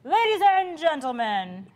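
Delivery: high, loud, drawn-out announcer call; game show hostess. Pretend. Guest showing off her practiced host voice, big game show hostess. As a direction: stretch it out like a hostess opening the show. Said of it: "my best game show hostess voice".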